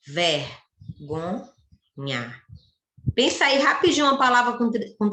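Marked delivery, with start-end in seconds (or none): 0.1-1.5 "Vergonha" slowly split into three parts, pausing after each; slow, chopped-up "Vergonha"; syllable by syllable. Shown as a demonstration. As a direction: sound out "Vergonha" one syllable at a time. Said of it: "Tem três sílabas".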